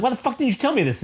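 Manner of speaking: high, indignant retold question; mock outrage. Daredevil complaining to Elektra, high mock outrage. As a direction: play it as exaggerated disbelief.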